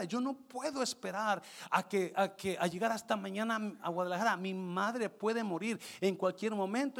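high, pressing plea of a distressed customer; retold crying woman. Mother of a thirteen-year-old explains his booking mistake, imitated tearful pleading. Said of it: "Y llora y llora la señora".

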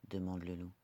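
ordinary delivery

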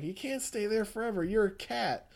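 lightly voiced creepy persuader; coaxing madman. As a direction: talk the cat down with a false promise. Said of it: "It's like".

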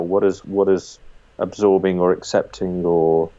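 ordinary talk, nothing marked

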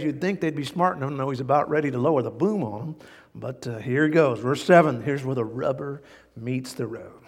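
normal talking voice

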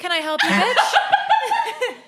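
sassy, rising to a high laughing bitch; mock manager comeback with a hair flip. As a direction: flip your hair and sass them. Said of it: "dramatic ass three sixty".

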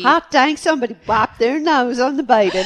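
put-on loud, high hillbilly accent. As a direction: holler it like a hillbilly. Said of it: "hillbilly accents".

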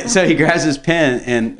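ordinary talk

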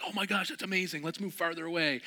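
put-on high, excited kid voice; amazed friends. Friends cheering the young shooter, high and excited. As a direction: gush like a wowed friend.

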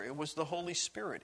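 normal talking voice

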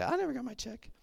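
put-on a sweet old Southern grandma, confused at the table; old Southern lady. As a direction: play a puzzled old Southern lady who missed her bill. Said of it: "she's an old Southern lady".

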